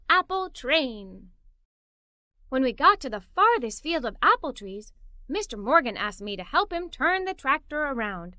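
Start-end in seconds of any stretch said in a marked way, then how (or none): none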